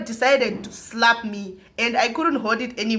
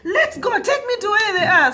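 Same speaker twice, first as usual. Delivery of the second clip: imitated scolding mum, rising higher and louder; mock maternal tirade; mother shouting; 6 semitones higher, 3 dB louder than usual. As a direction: tell off your child, building to an order. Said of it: "Start shouting at me".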